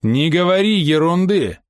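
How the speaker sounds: high, slow, menacing dismissal; dangerous. A narrator's reading. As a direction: cut off the talk with menace. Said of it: "бросила Фрэнсис с опасным выражением". (резко, с угрозой)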